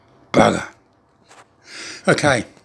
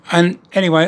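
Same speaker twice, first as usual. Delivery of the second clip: normal talking voice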